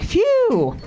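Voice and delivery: high mock sigh of relief; relieved phew. Mother relieved after late miscarriage, high phew. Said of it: "so I was like".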